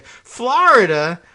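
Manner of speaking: high, slow, loud disbelief; surprised. Pretend. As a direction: act floored by it. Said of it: "I'd say".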